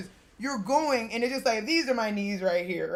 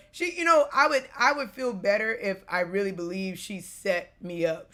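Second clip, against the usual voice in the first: ordinary talk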